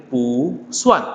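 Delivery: pu rising, suan falling; changed tone on pu. Shown as a demonstration. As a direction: lift pu before dropping suan. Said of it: "maka bacanya adalah jadi".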